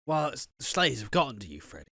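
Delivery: smug villain. Retold or imitated. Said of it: "he's like".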